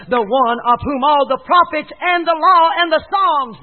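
ringing, high, loud sermon delivery; preach. Real, not acted. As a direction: proclaim it at full force from the pulpit.

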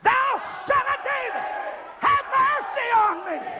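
high, straining plea for mercy; desperate cry. Shown as a demonstration. Leading the church in begging mercy, desperate cry. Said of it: "Now say it with desperation".

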